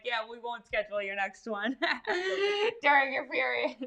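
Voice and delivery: laughing through the quote, voice breaking high; cracking up mid-sentence. Retold or imitated. Laughing while quoting tattoo artist.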